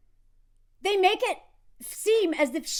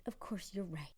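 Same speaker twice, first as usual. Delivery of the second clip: soft whisper of giving in; 7 semitones lower, 16 dB quieter than usual. Retold or imitated. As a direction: whisper it, resigned. Her reply in the quote.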